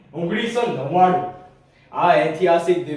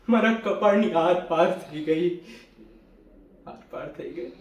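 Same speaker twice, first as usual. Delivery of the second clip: shock fading to a very soft echo; dying away; 5 dB quieter than usual. Acted. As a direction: let the words ebb away. Recounts fatal shot, echo dies away.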